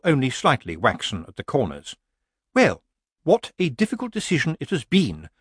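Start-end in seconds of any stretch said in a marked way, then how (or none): 2.5-5.3 falsely bright hostess, pitched up; brightly. Acted. Missus Earwig addresses the nervous crowd of witches, brightly. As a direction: announce it brightly in a higher voice. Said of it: "she said brightly".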